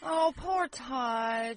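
slow, drawn-out mock pity; mock sympathy. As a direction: stretch it, pretend heartbroken.

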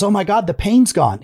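mock amazed relief, voice lifted. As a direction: play the stunned, delighted patient. Patient relieved after dental fix.

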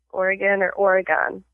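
"Oregon" as OR-uh-gun, then OR-uh-gone; change only the end of "Oregon", gun to gone; two pronunciations. Shown as a demonstration. Host quizzing a guest, "Oregon" said both ways. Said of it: "I have a pronunciation test".

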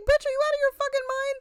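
high, fast, disbelieving yell; incredulous shout. Retold or imitated. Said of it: "everybody's like".

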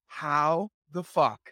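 HOW THE FUCK drawn out slowly; imitated disbelief. Wife phoning about the kidnapped cat, "How the fuck" slow in disbelief. Wife's disbelief on the phone, retold.

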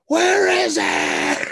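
slow, drawn-out mock demand; loud imitating growl; mock scream at a hostage; mock shout. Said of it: "Just screams him".

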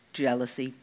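ordinary delivery

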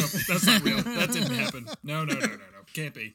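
half-laughed, acted refusal to believe; Hagrid in denial. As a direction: rattle off refusals in comic denial. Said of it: "Hagrid's just like".

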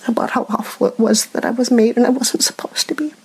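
breaking into a teary whisper; barely getting the words out through tears; real emotional whisper; tearful whisper. Real, not acted. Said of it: "I'm getting emotional again".

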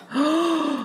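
sharp breathy intake; quick audible gasp. Real, not acted. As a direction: gasp in alarm. Gasps while telling the towing story.

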